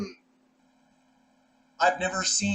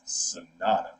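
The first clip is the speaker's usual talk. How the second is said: low, drawn-out Sonata; character voice imitation; 11 semitones lower, slower than usual. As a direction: imitate the way he says it. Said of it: "Just the way he says".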